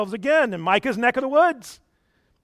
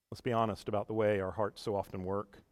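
ordinary talk

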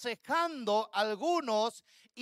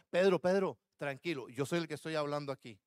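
gentle correction; 9 semitones lower, 3 dB quieter, faster than usual. Pretend. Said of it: "más suavecito".

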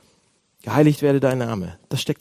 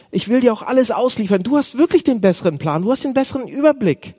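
higher, keen praying to the Father; eager mock prayer; 7 semitones higher than usual. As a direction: play a believer pledging everything. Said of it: "Wir sagen, ja, Vater". (gespielt eifriges, beteuerndes Gebet)

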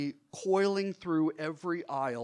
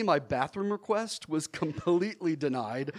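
plain reading voice

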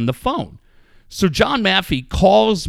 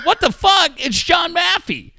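retold shout; 13 semitones higher than usual. Retelling his own answer to the boss, a high shout. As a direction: shout it back in disbelief. Speaker yelling back in story.

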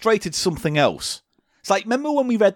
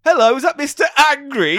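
an excited DJ greeting, higher and louder; Steve Wright DJ accent; 10 semitones higher, 5 dB louder than usual. Pretend. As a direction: DJ hamming it up for the caller. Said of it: "and I'll be Steve Wright".

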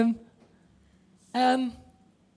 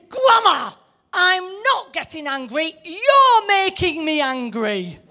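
put-on child's retort; 6 semitones higher, 3 dB louder than usual. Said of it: "he says".

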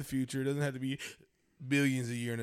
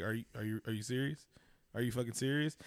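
low, soft mock incredulity; quiet disbelief; 4 semitones lower, 9 dB quieter than usual. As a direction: ask it softly, as if appalled. Acting out disbelief at fake talk.

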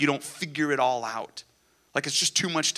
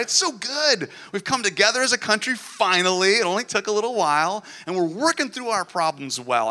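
mock-cheerful praise, pitched higher and louder; sarcastic; 6 semitones higher, 4 dB louder than usual. Preacher to congregation about politics, mock-cheerful sarcasm. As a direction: sell the good news with heavy irony.

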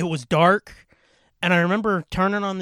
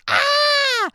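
much higher, shrill mock scream; girls' shriek; 5 dB louder than usual. Imagining a room full of screaming girls, a shriek.

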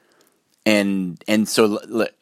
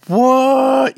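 high, loud shocked "What?"; mock disbelief, the voice leaping up; a squeaky, startled outburst; 8 dB louder than usual. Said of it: "And I was like".

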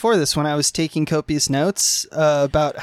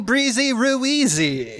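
sing-song name; 7 semitones higher, much slower than usual. Acted. Slow far sing-song name.

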